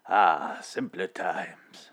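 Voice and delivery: slow, soft nostalgic sigh; old timey voice. Pretend. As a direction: linger on it like an old reel. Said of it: "old timey voice".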